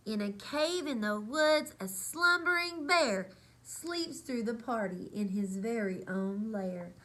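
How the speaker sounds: lively storybook read-aloud; reading to children. A narrator's reading. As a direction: read it like bedtime story time. Reads picture book aloud.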